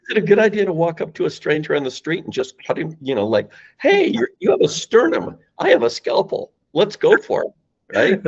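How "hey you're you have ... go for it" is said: high-pitched, hammed-up eagerness; comically keen, voice pitched way up; mock-eager pitch. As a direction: accost a stranger with absurd enthusiasm.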